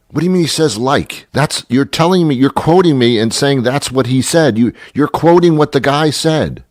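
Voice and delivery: high-pitched, indignant objection; exasperated challenge. Acted. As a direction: take a maddening talker to task. Challenges the storyteller's "like.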